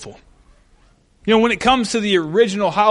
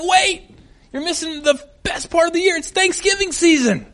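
pitched up high, eager and bright-eyed; high excited exclaim; 9 semitones higher than usual. Retold or imitated. Telling friends not to skip Thanksgiving, high eager exclaim. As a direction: sell the season with a lifted voice. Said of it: "I'm always like".

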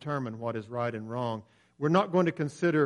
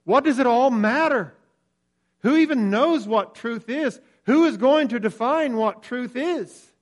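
loud, much higher scornful outburst; scoffing Pilate, louder and higher; contemptuous; 10 semitones higher, 10 dB louder than usual. Retold or imitated. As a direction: fling the questions out with loud contempt. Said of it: "Pilate is contemptuous".

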